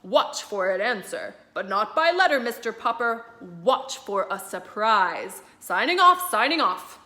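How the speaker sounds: broadcast it from far away; admiral on radio. Acted. Admiral Drake greeting Mister Popper over the radio, announcer-like.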